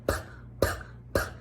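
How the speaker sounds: voiceless little puffs of air; puffing P sound. Shown as a demonstration. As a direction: pop the lips on each "puh". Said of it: "It comes out like a puffing sound".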